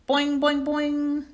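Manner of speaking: high, loud springy boings; frog twang. Acted. Frog Og replies to his friend with loud high boings. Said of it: "Og replied".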